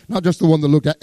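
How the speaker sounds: ordinary delivery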